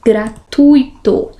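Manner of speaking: "Gratuito" slowly as gra-TUI-to; pronúncia certa. Shown as a demonstration. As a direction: stress TUI as one syllable in "Gratuito". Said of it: "É junto aqui". ("Gratuito" devagar, com ditongo tônico: gra-TUI-to)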